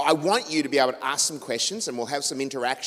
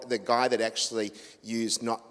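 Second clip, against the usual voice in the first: ordinary talk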